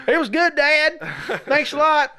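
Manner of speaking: a lightly voiced cheerful son; kid's voice. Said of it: "and he's like".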